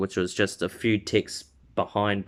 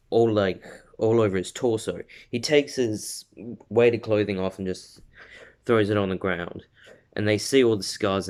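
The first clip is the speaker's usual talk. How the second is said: ordinary delivery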